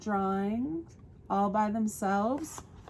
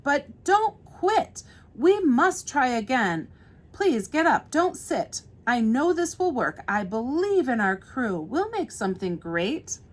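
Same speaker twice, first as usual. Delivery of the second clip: higher-pitched, insistent pep talk; encouraging storybook character; 5 semitones higher, 5 dB louder than usual. Acted. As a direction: plead with the crew to keep going. Voices Red pleading with the crew.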